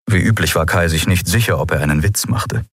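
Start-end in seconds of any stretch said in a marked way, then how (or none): none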